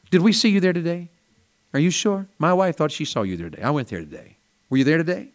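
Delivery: high, eager churchgoer asking; a church member quizzing a store clerk; acted chat. Acted grocery store exchange.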